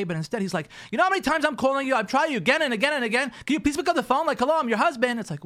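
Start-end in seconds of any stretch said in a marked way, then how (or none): 0.9-5.2 loud, high, fast exasperated complaint; hammed-up angry husband yelling into the phone. Husband berating wife for not answering, loud and fast.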